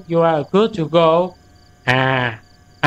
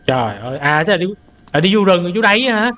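ordinary delivery

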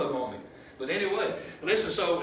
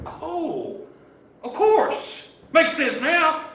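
higher, louder sudden understanding; dawning realization; 10 semitones higher, 7 dB louder, much slower than usual. Acted. Disciples realizing the scriptures at last, surprised. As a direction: sound like the light just came on.

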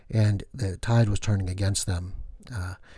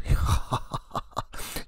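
vampire laugh; 3 dB quieter than usual. Acted. Vampire laugh after line.

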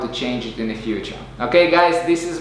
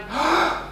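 sharp gasping inhale with a hook; quick hooked gasp in; hook breath gasp; 13 semitones higher, 4 dB quieter than usual. Shown as a demonstration. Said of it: "One more time".

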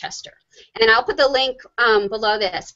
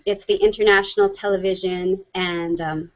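ordinary talk

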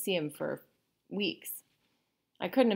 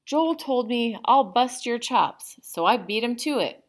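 put-on raised and loud, a boy standing his ground; defiant; 8 semitones higher, 8 dB louder than usual. A narrator's reading. Boy answering the principal, defiant and loud. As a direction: push back hard at the principal. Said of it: "I said defiantly".

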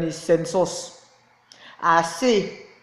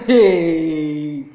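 "hey" stretched out through laughter; angry laugh; 3 semitones lower than usual. Real, not acted. Talking about the government that fought her, angry laughter. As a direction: laugh out a long, falling "hey". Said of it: "I'm laughing because I'm angry".

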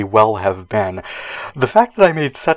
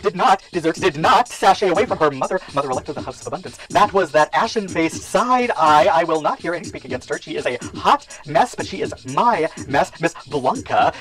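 high, grand ballroom mother voice; imperious house mother; 7 semitones higher than usual. Acted. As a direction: command the room like a house mother. House mother character monologue.

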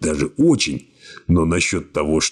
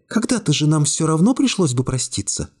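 put-on lightly raised young woman voice, cool and calm; young woman's voice; 10 semitones higher than usual. A narrator's reading. Secretary coolly refusing the hero's embrace, high young woman voice. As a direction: voice her higher, calmly distant.

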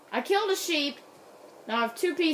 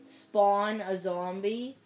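ordinary talk, nothing marked

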